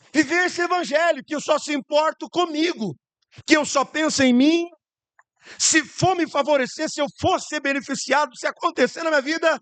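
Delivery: high, building sermon; preaching at full force. Acted. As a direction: preach it out to the church. (pregação fervorosa)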